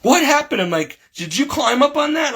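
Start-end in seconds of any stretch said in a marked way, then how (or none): 0.0-0.6 high, louder startled demand; alarmed questioning. Retold or imitated. Mother to child beside the fallen dresser, alarmed. 1.1-2.4 alarmed questioning. Retold or imitated. Mother questions child after crash.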